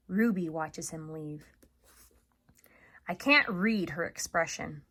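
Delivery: reading a novel aloud to a class; story narration. A narrator's reading. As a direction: tell the story steadily to young listeners. Teacher reads novel aloud.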